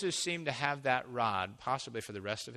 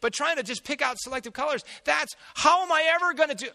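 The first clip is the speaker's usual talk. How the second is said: higher, louder, fast whiny protest; exasperated complaint; 12 semitones higher, 9 dB louder than usual. Retold or imitated. Preacher mock-praying to the Lord, exasperated and whiny. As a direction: pour out the frustration to the Lord, voice climbing. Complaining to God about video work.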